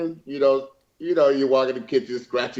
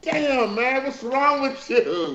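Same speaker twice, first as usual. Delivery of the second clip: mock exasperation; 10 semitones higher, much slower than usual. Retold anger at Melvin, laughing.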